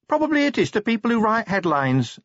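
high-pitched junior officer voice; small high character voice; Cheery. A narrator's reading. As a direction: pitch it well up, deferential. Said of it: "said Cheery".

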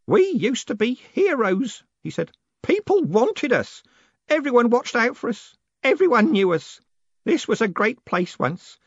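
remember lost glory, voice close to breaking; old postman lamenting past glory; tearful old postman. A narrator's reading. Grote mourns the old days.

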